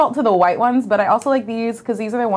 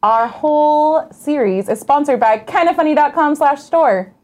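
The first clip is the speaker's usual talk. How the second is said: muffled by a hand on the mic; speaking into a covered mic; finger over the mic; 6 semitones higher, 4 dB louder, slower than usual. Real, not acted. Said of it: "You're covering your mic".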